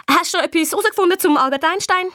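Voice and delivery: put-on high, fast, pushy girl on the phone; annoying Gina. A narrator's reading. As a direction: quiz him in a grating high voice. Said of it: "ihre Stimme tönt am Telefon noch nerviger". (nervig drängelnd)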